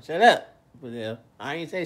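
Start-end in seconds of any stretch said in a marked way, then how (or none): 0.0-0.5 a sudden loud snapped order; loud shut up. Retold or imitated. Telling people to stop talking, loudly. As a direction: bark it out to silence them. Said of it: "That's what I say, "God damn".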